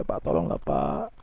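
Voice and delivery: hushed, retold begging; understated, nearly voiceless pleading; imitated tearful plea. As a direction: mimic a tearful appeal very softly. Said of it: "sambil dia nangis".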